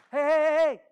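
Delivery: high, loud string of heys; calling out. Retold or imitated. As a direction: holler to get their attention. Calling out to family in retold talk.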